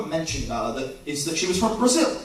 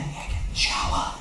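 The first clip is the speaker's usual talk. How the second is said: breathy hushed invitation to the shower; whispered come-on; 6 dB quieter than usual. Retold or imitated. Lover inviting him into the shower, whispered. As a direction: whisper it like a seduction. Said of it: "It's like".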